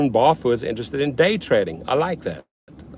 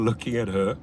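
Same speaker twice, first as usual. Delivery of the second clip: ordinary talk, nothing marked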